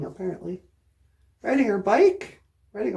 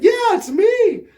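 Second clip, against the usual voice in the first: loud, high and slow wolf shout; 8 semitones higher, 6 dB louder than usual. Acted. Wolf in grandma's clothes shouts at Red, loud. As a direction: bellow it out as the wolf. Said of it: "the Big Bad Wolf's like".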